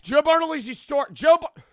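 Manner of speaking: high, loud yell that breaks off; shouted plug. Real, not acted. Streamer retries his store plug, shouted. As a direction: yell the store name at full pitch.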